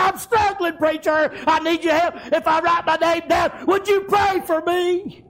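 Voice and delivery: a hint of a struggling man begging for help; pleading man. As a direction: beg the preacher to pray for you. Man pleading with preacher for prayer.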